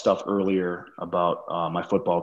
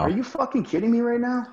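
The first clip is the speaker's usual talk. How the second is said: much higher, incredulous squeal; shocked disbelief. Retold or imitated. Retelling his shock at the lost job, high incredulous squeal. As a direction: voice jumps way up in outrage.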